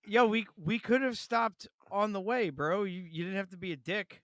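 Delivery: exasperated mock shout at the movie villain; mock exasperated yell. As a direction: yell it like a fed-up buddy, bro. Mock complaint to Jon Voight's character.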